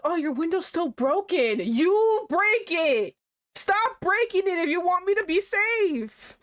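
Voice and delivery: high, mock scolding whine; mock nagging. Mocking the boyfriend over the broken window, high whiny nag. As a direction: nag him in a high put-on voice. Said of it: "So he keeps going on, like".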